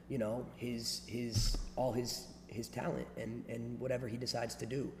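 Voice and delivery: quiet, subdued and somber; soft, measured, rehearsed regret; somber scripted apology. Real, not acted. Somber farewell to departed friend.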